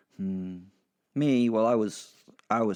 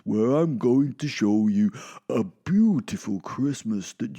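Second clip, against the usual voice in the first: slow, louder grand Santa voice; solemn Santa; 5 dB louder than usual. Acted. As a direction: intone it like a wise Father Christmas. Santa explains the journey.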